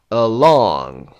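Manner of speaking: along with LONG punched, drawn out; stress on second syllable. Shown as a demonstration. Teacher working through the exercise, second-syllable stress on along. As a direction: lean hard on the end of along. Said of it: "second syllable".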